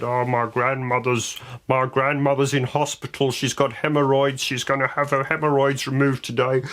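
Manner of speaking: milk the sob story for sympathy; mock sob story. Mimicking contestant's sob story.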